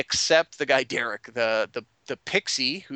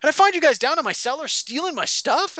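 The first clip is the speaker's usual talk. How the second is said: high-pitched acted accusation; outraged Bertram; 13 semitones higher, 4 dB louder than usual. Bar owner finding guests in his cellar, outraged. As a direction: accuse them, voice climbing in outrage. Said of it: "He goes".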